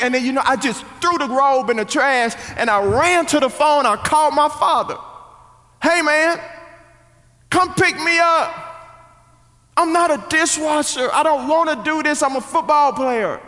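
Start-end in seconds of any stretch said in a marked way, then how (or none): 5.8-13.4 high-pitched, insistent demand; panicked pleading. Retold or imitated. Teenage son phoning his father to quit, panicked pleading. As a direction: plead to be rescued, voice pushed up high.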